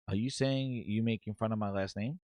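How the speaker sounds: low, soft, loaded question; quiet confrontation. Retold or imitated. Booty confronts HR about his last name, quiet confrontation. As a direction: challenge them under your breath, low.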